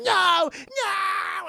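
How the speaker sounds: high, loud wailing shout; mock terrified scream. Man dragged through a mirror, high mock scream. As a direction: scream it out like a victim.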